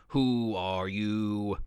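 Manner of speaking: low, slow growling monster. Acted. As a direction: growl the question like a beast. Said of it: "growled toward".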